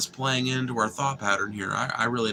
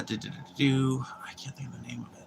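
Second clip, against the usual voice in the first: hushed murmur while searching memory; whispering to self; 5 dB quieter, faster than usual. Real, not acted. Streamer whispering while trying to recall a restaurant name. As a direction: whisper it under your breath.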